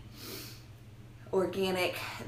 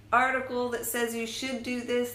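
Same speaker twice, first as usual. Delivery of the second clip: ordinary talk, nothing marked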